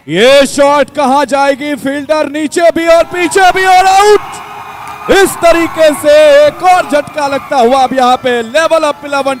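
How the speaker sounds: shouting the wicket, high and loud; excited cricket commentary. Real, not acted. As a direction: erupt as the catch is taken.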